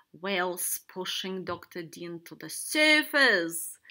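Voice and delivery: slow, loud announcement; big drawn-out cheer-like shout. A narrator's reading. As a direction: shout the news, stretching it out. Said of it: "Shark and Octopus shouted".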